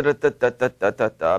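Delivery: ordinary talk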